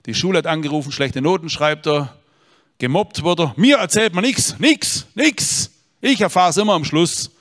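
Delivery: high, loud exasperated complaint; frustrated pastor listing his son's failings; ranting father. Retold or imitated. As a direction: rattle off the grievances in rising despair. Voicing the despairing priest.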